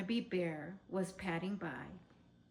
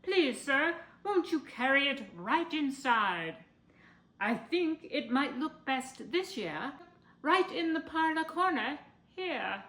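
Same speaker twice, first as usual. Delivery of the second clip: Mister Willoughby; 5 semitones higher, 7 dB louder than usual. A narrator's reading. Willoughby instructing about the tree.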